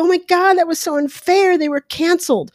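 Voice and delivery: high-pitched whiny protest of the public; exaggerated high voice of indignant complainers; mock outrage. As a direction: imitate people crying unfair, pitched well up. Acting out people's outraged complaint.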